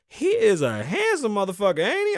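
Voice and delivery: high, loud mock admiration; gushing imitation. Doctor behind the door raving about a patient, high gushing imitation. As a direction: swoop way up, imitating her gushing.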